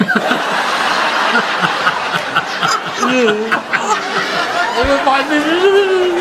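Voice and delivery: long wheezy laugh after the punchline; hearty laugh. Real, not acted. Joker laughing at his hairbrush punchline, laughing. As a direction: laugh it out hard.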